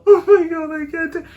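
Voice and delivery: high wailing cry, mocking a weepy bride. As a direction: wail it up high like a crybaby. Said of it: "it's not no".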